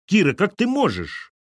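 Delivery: high, loud indignant protest; shouting in reproach. A narrator's reading. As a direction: cry out, scandalised by her. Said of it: "хором закричали тёща и свекровь". (возмущённо, высоко и громко)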